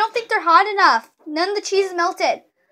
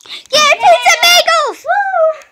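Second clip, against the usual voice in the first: excited cheer; 11 semitones higher, 9 dB louder, slower than usual. Real, not acted. Cheering for snack.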